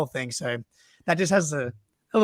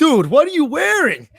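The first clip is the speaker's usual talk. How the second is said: loud, high Boston bro shout; big Boston fan yelling across a crowd; Boston accent; 12 semitones higher, 12 dB louder than usual. Retold or imitated. Boston fan calling out to a stranger, loud Boston accent. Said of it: "that's the accent".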